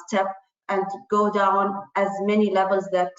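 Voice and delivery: usual speaking voice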